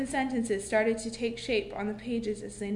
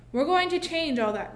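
determined; 6 semitones higher, 5 dB louder than usual. A narrator's reading. Teacher making promise.

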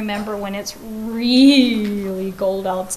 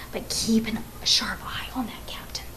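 whispering; 6 dB quieter than usual. A narrator's reading. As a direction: hush it to a whisper. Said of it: "lowered his voice".

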